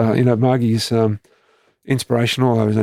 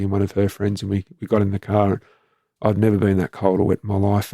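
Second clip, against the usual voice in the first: ordinary talk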